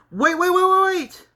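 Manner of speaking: high, loud rapid string of waits; urgent interruption. Acted. Character halting a final fight, urgent and high. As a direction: blurt it out high to halt everything.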